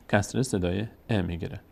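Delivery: ordinary delivery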